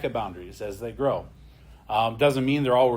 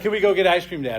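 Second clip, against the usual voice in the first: put-on higher, louder pleading little girl; imitating daughter asking; 6 semitones higher, 5 dB louder, faster than usual. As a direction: mimic a kid begging dad for ice cream. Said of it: "she'd be like".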